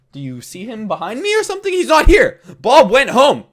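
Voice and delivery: exasperated, rising into a loud insistence; acted exasperation with a pesky visitor; exasperated reply.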